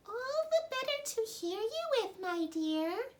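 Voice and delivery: very high, sly granny-wolf voice; wolf pretending to be grandmother; sneaky wolf. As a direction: purr it like a wolf in disguise. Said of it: "said the sneaky wolf".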